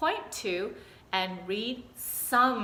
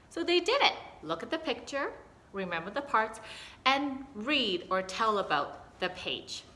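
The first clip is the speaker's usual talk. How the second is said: ordinary talk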